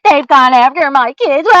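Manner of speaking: building mock-victim complaint, mildly hammed; mocking whine. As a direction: parody his self-pity as it swells. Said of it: "As you sit over there".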